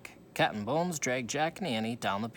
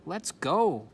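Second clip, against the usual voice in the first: cried out; 6 semitones higher, 7 dB louder than usual. A narrator's reading. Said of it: "cried Annie".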